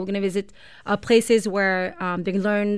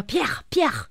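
mock French accent; 5 semitones higher than usual. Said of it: "my friends always tease me".

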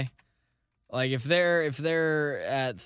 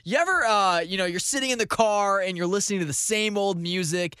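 mock ad read; 4 semitones higher than usual. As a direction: sell it like a cheesy radio ad.

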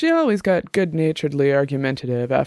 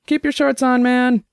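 high, louder, cheeky; teasing; 10 semitones higher, 3 dB louder than usual. Acted. Jenny teasing the barman to be patient, cheeky and loud. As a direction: tease the impatient barman.

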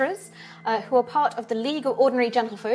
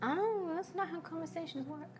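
put-on little girl voice; 5 semitones higher, 14 dB quieter than usual. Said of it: "young dwarven girl".

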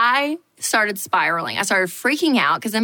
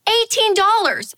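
"Eighteen dollars" much higher, slower, drawn out; stunned outrage; 12 semitones higher than usual. Shown as a demonstration. Repeating the lemonade price to the audience in stunned outrage. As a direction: drag out the price in disbelief.

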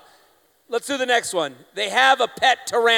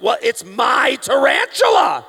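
mock offended pet owner; 3 semitones higher, 5 dB louder, slower than usual. Tarantula owner voiced in retelling.

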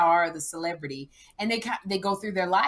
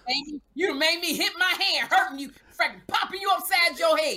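mother warning her daughter she'll get popped; hammed-up threatening mom; 7 semitones higher than usual. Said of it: "redo it as Sherry".

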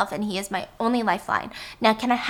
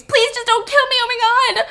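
high, louder terrified plea; scream; 13 semitones higher, 6 dB louder than usual. Acted. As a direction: scream for your life. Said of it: "Whiskey screams".

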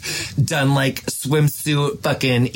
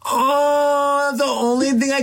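touched oh; 10 semitones higher, much slower than usual. Real, not acted. Moved by baby kicks.

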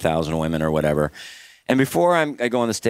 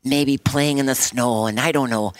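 put-on slow, dreamy Canadian accent, a touch higher; 5 semitones higher, slower than usual. Prayer lady pictures God peeing in snow, slow Canadian accent. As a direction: imitate the earnest Canadian prayer, unhurried. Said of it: "she had the Canadian accent".

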